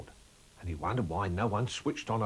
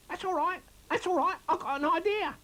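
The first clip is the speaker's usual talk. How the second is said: squeaky rabbit voice; 3 dB louder than usual. Acted. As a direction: squeak it out like a small excited rabbit. Narrator voices Chris Rabbit.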